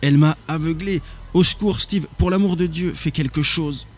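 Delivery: high, desperate cry for help; panicked plea. A narrator's reading. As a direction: beg your brother to save you. Blinded brother begs for help.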